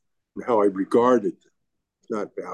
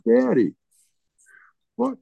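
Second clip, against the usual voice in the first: put-on much higher, childlike daughter's voice; little daughter; 13 semitones higher than usual. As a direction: voice a worried young girl asking why. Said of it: "She said".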